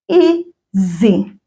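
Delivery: "Izinsuku" broken into separate syllables; slowly. Shown as a demonstration. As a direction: teach "Izinsuku" slowly, one syllable at a time. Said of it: "we'll say it slowly".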